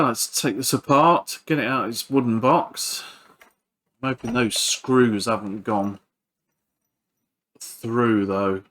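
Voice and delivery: ordinary talk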